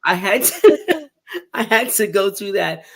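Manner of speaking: laughing through the words, pitch jumping up; real laughter breaking into the telling. Real, not acted. Describing her crushed legs to S1, laughing.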